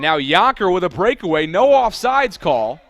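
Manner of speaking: loud, high, urgent breakaway call; excited play-by-play. Real, not acted. Commentator calling Yonker's breakaway with no offsides, excitedly. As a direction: raise the voice as the attack breaks.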